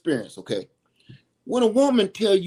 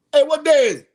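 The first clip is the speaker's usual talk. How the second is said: calling out; 6 semitones higher, 7 dB louder, much faster than usual. Real, not acted. Suddenly calls out off-topic.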